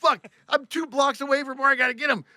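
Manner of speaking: high-pitched frustrated outburst; exasperated. Retold or imitated. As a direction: protest at the rotten timing. Retold exasperation near destination.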